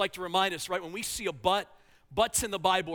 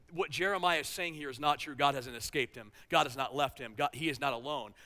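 usual speaking voice

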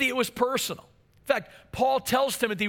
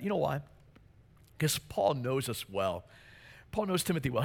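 ordinary talk, nothing marked

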